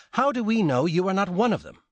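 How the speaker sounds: demanding. Acted. Wife accuses the stranger of being a gang member, high and loud. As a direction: she challenges him outright, voice up high. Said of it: "Susanna demanded".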